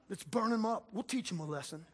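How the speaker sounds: usual speaking voice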